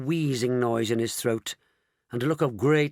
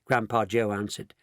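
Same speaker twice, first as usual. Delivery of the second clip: ordinary delivery, nothing marked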